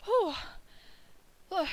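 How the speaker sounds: panting after running; out of breath. Acted. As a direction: speak while catching breath. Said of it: "let me catch my breath".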